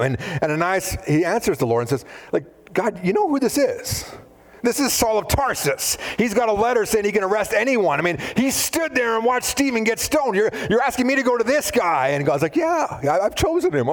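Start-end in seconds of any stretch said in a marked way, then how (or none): 2.7-12.1 Ananias protesting. Acted. 12.5-13.9 God's calm answer. Acted. God answering Ananias.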